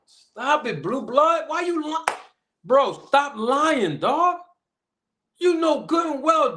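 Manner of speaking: high, drawn-out disbelieving "stop lying"; exasperated scolding. Real, not acted. Host scolding rival Blue Blood for lying, high and exasperated. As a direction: scold him like you can't believe it.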